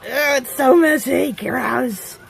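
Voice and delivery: high, drawn-out squeal of disgust; grossed out. Real, not acted. Vlogger with melted bath melt on hands, grossed out. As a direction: whine at the sticky mess.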